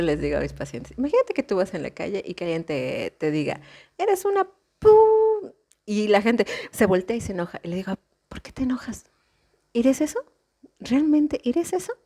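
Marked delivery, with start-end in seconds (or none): 4.0-5.5 high, loud, slow street insult; a hammed-up insult yelled high and slow; mock shouted insult. 8.3-11.9 questioning. Retold or imitated. Therapist challenges angry patient.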